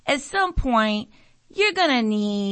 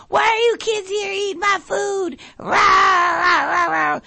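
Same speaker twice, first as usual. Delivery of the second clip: high crying shout, hammed-up crazy mom; crazed mother yelling at teens over her food; acting all crazy; 10 semitones higher, 4 dB louder than usual. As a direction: scare the kids off with a wild shrieking act. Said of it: "Trying to act all crazy".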